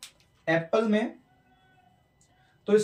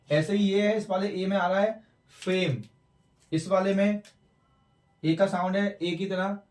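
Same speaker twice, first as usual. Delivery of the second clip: ordinary talk, nothing marked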